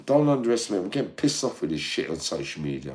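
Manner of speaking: ordinary delivery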